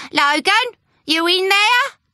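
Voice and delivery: high loud call through a door. Acted. Landlady calling for the tenant through the door, loud. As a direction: call out to the room.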